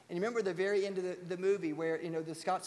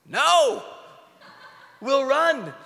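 smart aleck; 7 semitones higher, 13 dB louder, much slower than usual. Retold or imitated. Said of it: "some veteran smart aleck goes".

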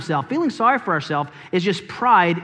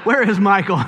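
laughing. Real, not acted. Laughing at a mistimed amen.

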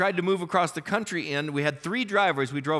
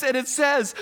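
usual speaking voice

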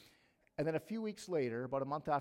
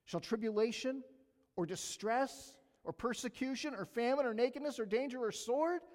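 rising, louder sermon reading of Romans; preaching scripture; 6 semitones higher, 4 dB louder than usual. Acted. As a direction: preach it building to a climax. Reads scripture building to full force.

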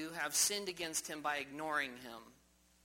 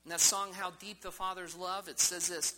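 ordinary talk, nothing marked

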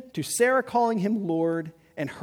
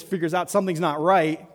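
ordinary delivery

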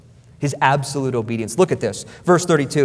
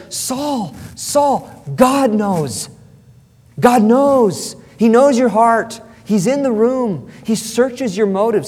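high-pitched, exasperated pleading; urgent preacher's reproach; impassioned appeal; 6 semitones higher, 3 dB louder, slower than usual. Acted. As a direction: implore him to face the truth. Preacher appeals directly to Saul.

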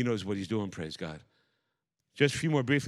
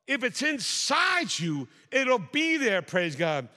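fiery preaching; 8 semitones higher than usual. Acted. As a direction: preach it hard to the congregation. Preaching builds to full force.

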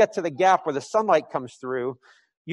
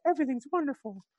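higher, softer, sugary fake-blissful voice; mock saccharine bliss; 9 semitones higher, 5 dB quieter, faster than usual. Preacher mocking beatific painted saints, sugary sweet. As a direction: sing it out like a painted saint with a frozen smile. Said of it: "beatific look".

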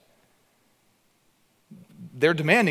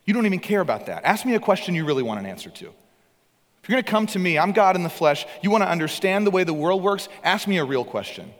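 higher, louder fiery challenge to the Pharisees; preaching at full force; 6 semitones higher, 4 dB louder than usual. Acted. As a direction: confront them from the pulpit, building.

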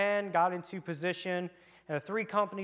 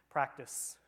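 normal talking voice

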